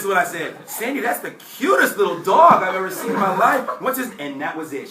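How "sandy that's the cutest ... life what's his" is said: high, loud gushing over a cute dog. Retold or imitated. Young applicant gushing to receptionist about her dog, high and loud. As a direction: lay it on thick about the dog.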